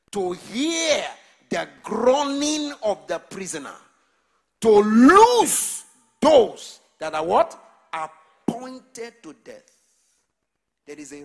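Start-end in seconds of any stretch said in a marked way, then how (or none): 0.1-7.6 high, loud, slow shouted scripture; preaching at full force. Real, not acted. Preacher crying scripture to the congregation, loud and high. 7.9-9.7 slow and heavy; slow, drawn-out finish. Real, not acted. Preacher finishing the verse slowly to the church.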